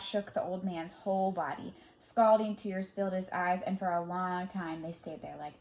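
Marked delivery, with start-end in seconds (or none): none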